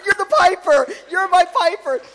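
loud, high delighted cry; excited shout of discovery; joyful exclaim. Retold or imitated. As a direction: burst out in joy. Joyful recognition of her piper.

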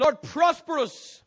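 slow, high, loud cry; fervent slow prayer shout. Acted. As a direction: stretch out each word, shouting. Call and response prayer.

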